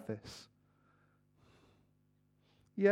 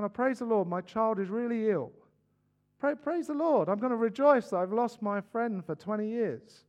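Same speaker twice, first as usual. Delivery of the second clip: raised pitch, put-on praise; mock rejoicing; 5 semitones higher, 4 dB louder than usual. Preacher parodying Christians who praise God through loss, mock-jubilant. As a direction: play at exulting over terrible news.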